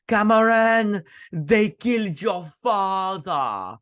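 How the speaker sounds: high, slow, hammed-up cry to Cameron; soap-opera heroine breaking bad news to Cameron, hammed up; mock dramatic reveal. She's like, dramatic quote.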